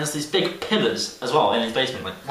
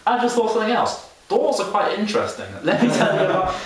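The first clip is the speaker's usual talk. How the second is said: rambling mock guide, rising higher; lightly voiced guide jumping to new thoughts; mock tour guide; 7 semitones higher than usual. Teen mimicking a rambling guide about doors, mock enthusiasm. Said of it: "she's like".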